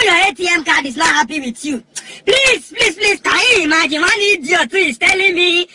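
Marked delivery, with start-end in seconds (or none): 2.3-5.7 high-pitched, agitated plea; indignant. Acted. Telling everybody about one idiot, indignant. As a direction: protest in raised, outraged pitch.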